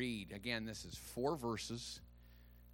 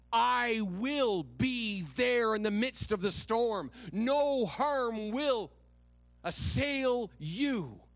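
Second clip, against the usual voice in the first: slow, steady, reassuring voice of God; God's promise; 9 semitones higher, 6 dB louder, much slower than usual. Acted. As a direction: promise it calmly and slowly. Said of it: "Your God says".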